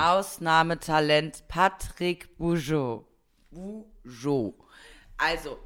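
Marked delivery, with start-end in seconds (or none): 0.0-4.5 slow, louder showman intro; grand introduction. Pretend. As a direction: announce him like a star.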